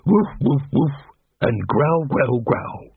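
bored dog. A narrator's reading. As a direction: bark and growl spelt out in words. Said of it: "in a bored way".

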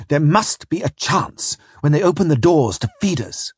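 cling to the last hope, pleading; desperate. Acted. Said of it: "I went on desperately".

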